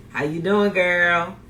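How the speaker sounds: normal talking voice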